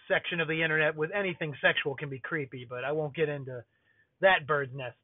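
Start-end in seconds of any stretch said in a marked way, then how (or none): none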